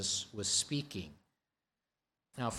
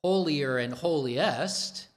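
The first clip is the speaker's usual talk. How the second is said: usual speaking voice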